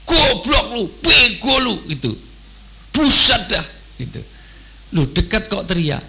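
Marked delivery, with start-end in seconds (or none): 0.1-1.7 high, loud mock yell of insults; mock angry shout. Preacher mimics an angry man yelling insults up close, mock shout. 3.0-3.7 loud, high exasperated outburst; mock angry yell. Preacher mimics a yelling man, loud exasperated mock shout.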